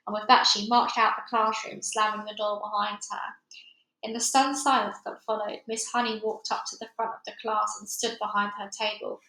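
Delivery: plain reading voice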